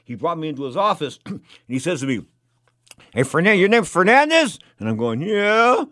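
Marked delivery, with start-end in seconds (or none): 3.1-4.6 loud, high-pitched boss calling out; imitating gruff boss. Casino owner questions his nervous new hire, imitated boss bellow. 5.2-5.8 mock intimidated. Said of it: "I'm a little intimidated".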